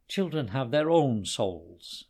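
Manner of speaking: usual speaking voice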